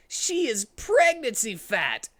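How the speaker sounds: slow, high amazed line; mock shocked Sonic. Sonic thinks about Rouge, slow amazed voice. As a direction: stretch it out in disbelief.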